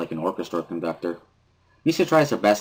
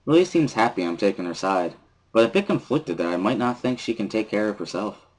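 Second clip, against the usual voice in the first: plain reading voice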